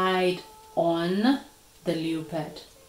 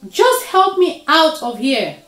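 high, loud demand for help; urgent cry from the pit; desperate plea; 9 semitones higher, 10 dB louder than usual. A narrator's reading. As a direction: beg loudly to be pulled out. Trapped Leopard demands help.

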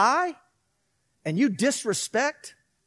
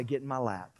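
softer, lower loving voice; hushed loving God; tender God; 8 semitones lower, 11 dB quieter than usual. Retold or imitated. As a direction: speak as a gentle father welcoming the child. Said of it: "He's gonna say".

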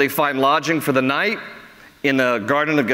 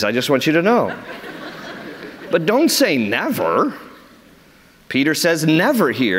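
ordinary delivery

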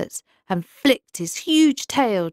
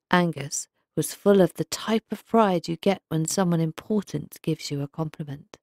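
ordinary delivery, nothing marked

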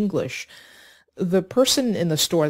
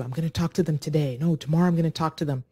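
procrastinating self-talk; much faster than usual. Retold or imitated. Voicing procrastinating inner monologue.

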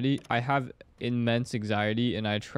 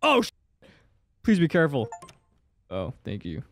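ordinary talk

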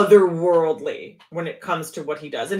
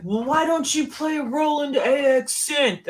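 higher-pitched, drawn-out imitation of a nagging question; slow, raised-pitch mimicry of everyone asking; mock questioning; 7 semitones higher than usual. Imitating everybody questioning the player's choice of synth, mock questioning.

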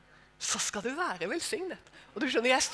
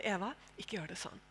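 quiet restraint; 5 semitones lower, 13 dB quieter than usual. Retold or imitated. Husband restraining her gently.

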